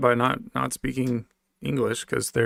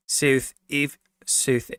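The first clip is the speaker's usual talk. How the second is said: South African accent attempt; much slower than usual. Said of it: "Trying to get that accent down".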